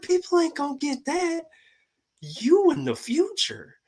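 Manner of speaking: replaying his own amazed words to her, high up; excited retelling. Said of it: "I'm like".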